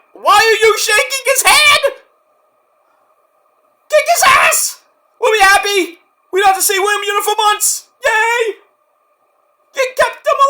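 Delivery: high, loud exasperated rant; irate fan yelling. Acted. Fan scolding Edge for shaking Yuta's hand, irate yelling.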